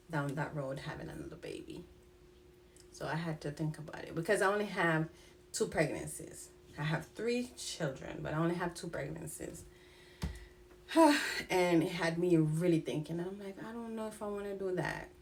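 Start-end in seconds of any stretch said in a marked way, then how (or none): 0.1-1.8 hushed doubt. Real, not acted. Doubts about another pregnancy. 10.9-11.4 a long audible sigh, pitch jumping up; heavy sigh. Real, not acted. Weary reflection on pregnancies.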